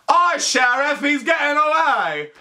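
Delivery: high, shouted British deputy; British accent. Shown as a demonstration. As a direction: cry out in a hammy British accent. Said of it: "That's my British accent".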